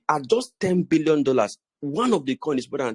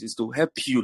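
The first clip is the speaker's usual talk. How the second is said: ordinary talk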